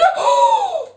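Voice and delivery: long high-pitched gasp of shock; high astonished gasp. Real, not acted. Streamer seeing the viewer count jump, astonished gasp.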